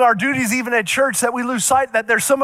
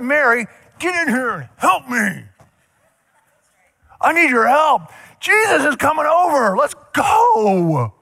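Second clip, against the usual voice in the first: a touch of an impatient hostess calling her sister; mild mock bossy Martha; 5 semitones higher than usual. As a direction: hurry Mary along, lightly hammed-up. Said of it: "And she's getting mad. She's like".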